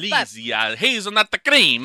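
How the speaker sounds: hammed-up Italian, rising high and loud; mock Italian accent. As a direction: relish it like a stage Italian. Playing an Italian over the sweets.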